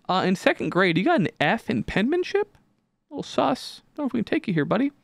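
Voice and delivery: imitating a college judging his grades, mock dubious; mock admissions officer. High, doubting mock official voice. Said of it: "They're gonna be like".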